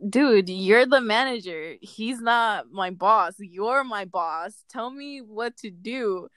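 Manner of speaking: slow, emphatic mock scolding; drawn-out, exasperated pleading in a retelling; exasperated retort. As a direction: spell it out slowly for a clueless boss. Retold confronting of an unhelpful manager.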